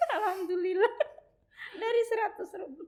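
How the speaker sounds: laughing high with delight. Real, not acted. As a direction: laugh through the words. Happy recalling her small start.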